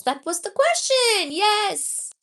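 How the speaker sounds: high, loud burst of enthusiasm; thrilled by a viewer's question; excited. Real, not acted.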